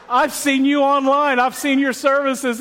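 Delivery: a stranger thrilled to place a familiar face; excited recognition. Retold or imitated. Stranger at Starbucks recognizing the pastor, excited and slow.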